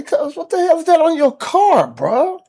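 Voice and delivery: high, loud shocked cry; shocked outburst. Retold or imitated. To cousin on seeing litter on his SUV, high shocked cry. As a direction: yell it out in disbelief. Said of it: "I say".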